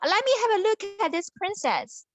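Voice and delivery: a curious hero demanding to see her; eager prince. Acted. Prince insisting to the crowd, high and eager.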